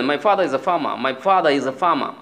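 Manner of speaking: "father" as "fah-dah", "farmer" as "fah-mah"; British silent r. Shown as a demonstration. Teacher modelling a British sentence, "farmer" as "fah-mah". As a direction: drop every r in "father" and "farmer", slowly. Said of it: "তাহলে আপনি বললেন".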